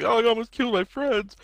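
hammed-up sobbing boy; mock crying. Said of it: "he started crying".

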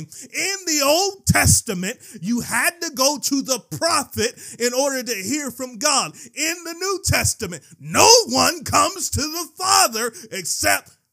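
high, full-force preacher shout; impassioned pulpit yelling; excited yelling. Real, not acted. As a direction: yell it out, pitched high. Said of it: "excuse the yelling".

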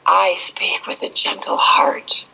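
slow, breathy whisper of a mantra; soft whisper. Retold or imitated. Retelling his tearful mantra in a slow whisper. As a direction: whisper it gently, slowly. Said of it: "the words he said when".

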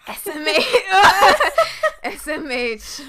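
loud laughing teasing. Real, not acted. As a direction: tease while cracking up.

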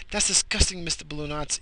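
high teasing disgusted voice; mock disgust.

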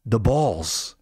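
usual speaking voice

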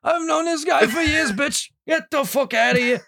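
put-on high, cranky old man snapping; grumpy old man. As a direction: bark like a crabby old geezer. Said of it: "I'm gonna be a grumpy old fuck".

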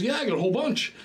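high-pitched, oblivious enthusiasm; hammed-up eager reply; mock cheerful boast. Acts the guy proud of bananas.

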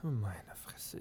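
exasperated whisper under the breath; whispered, disgusted; whispered curse. Acted. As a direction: breathe it out, fed up. Exasperated aside to group.

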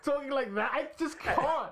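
ordinary delivery

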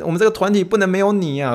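ordinary delivery

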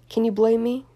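loud, cocky retort; confident. A narrator's reading. Tipsy Draco answers Granger's accusation, loud and cocky. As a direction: toss it back with swagger. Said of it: "Draco responded confidently".

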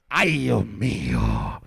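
slow, very low groan; dismayed groan. Acted. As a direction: drag it out low and heavy. Mock dismay after punchline.

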